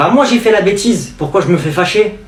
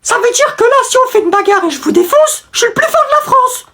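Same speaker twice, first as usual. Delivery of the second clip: much higher, excited little voice; eager kid calling out. Acted. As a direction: call out to the president eagerly. Élève pose sa question. (gamin surexcité, voix très aiguë)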